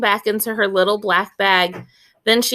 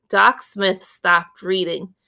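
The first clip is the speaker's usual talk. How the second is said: usual speaking voice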